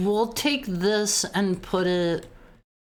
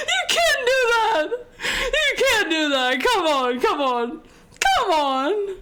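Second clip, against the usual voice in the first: high, laughing-crying, a bit louder; laughing protest; 11 semitones higher, 3 dB louder, slower than usual. Real, not acted. Streamer protests to chat while laughing hard. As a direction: plead through tears of laughter.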